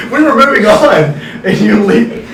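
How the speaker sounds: high laughing accusation; laughing complaint. Real, not acted. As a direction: laugh while scolding a friend.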